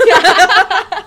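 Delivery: high burst of laughter; laughing hard. Real, not acted. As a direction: laugh through the word.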